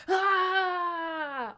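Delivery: high, loud charging yell; a warrior screaming as soldiers attack; battle cry. Acted.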